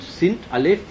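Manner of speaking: ordinary talk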